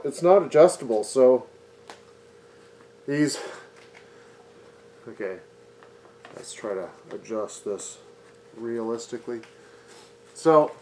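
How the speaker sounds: muffled behind paper mask; speaking through face covering; through a dust mask. Real, not acted.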